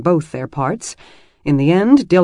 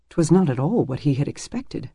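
ordinary delivery

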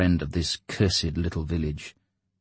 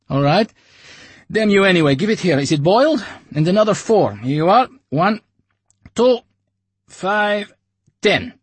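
higher, louder grudging; grumbling buyer; 14 semitones higher, 8 dB louder than usual. Acted. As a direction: count out coins grudgingly. Pays, counting cartridges.

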